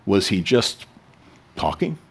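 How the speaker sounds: normal talking voice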